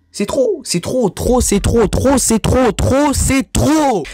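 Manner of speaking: beside himself. Real, not acted. Vlogger beside himself over the snubs, angry outburst. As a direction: hammer "trop" in rising fury. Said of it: "Je suis hors de moi".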